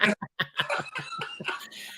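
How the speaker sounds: a real laugh jumping way up high; high squealing laugh. Real, not acted. Host cracking up over his lemur joke, squealing laugh. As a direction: burst into a squeaky giggle.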